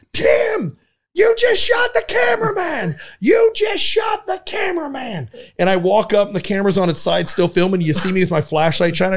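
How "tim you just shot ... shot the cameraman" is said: high shouting disbelief; retold panicked shout. Telling the story, he voices his own high shocked shout at Tim. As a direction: shout it in mock horror. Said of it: "I go".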